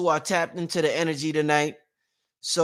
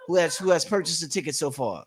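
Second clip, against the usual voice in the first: usual speaking voice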